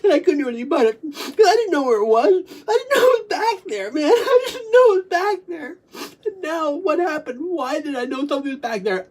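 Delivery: high, wailing half-laughing sob; real tearful distress. Real, not acted. Speaker overwhelmed by a returning memory, tearful distress. Recalling a buried memory in tears.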